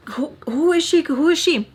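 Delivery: high-pitched imitated demand; mock indignant questioning. Acting out a rival's jealous questioning.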